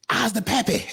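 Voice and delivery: high comic voice with laughter; playful high-pitched boast; mock proud pappy. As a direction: pretend to crow it down the street. Suggests comic line to shout in street.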